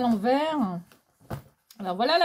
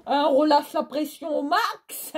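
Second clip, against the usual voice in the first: raised, loud, wound-up voice; over-excited; 5 semitones higher, 5 dB louder than usual. Real, not acted. Vlogger telling herself to release the pressure, over-excited. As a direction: hype yourself while claiming to unwind. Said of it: "il faut que je me calme là".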